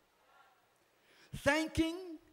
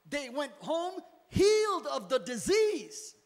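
loud, driving pulpit preaching; 4 dB louder than usual. Acted. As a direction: preach it hard to the congregation. Preaching at full force.

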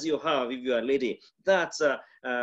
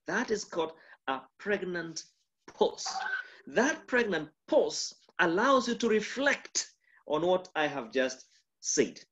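usual speaking voice

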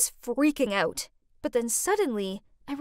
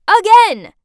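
loud, high burst of outrage; exasperated outburst; 8 semitones higher, 17 dB louder than usual. A narrator's reading. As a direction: blurt it out, fed up. Exasperated at finding mother again.

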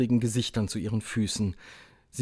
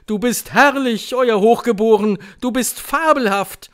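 high, loud gushing praise; adoring cheers; 11 semitones higher, 9 dB louder than usual. A narrator's reading. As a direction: cry out your worship of the leader. Witches cheering their leader. (begeisterte Jubelrufe, hoch und laut)

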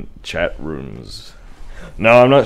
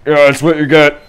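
gloating cheer; 8 semitones higher, 10 dB louder than usual. Real, not acted. Gloating after a kill.

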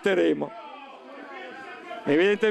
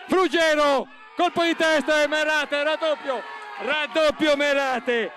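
breathless italian goal commentary; 7 semitones higher, slower than usual. Real, not acted. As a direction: yell the goal, stretch raddoppio slowly. Second goal called live.

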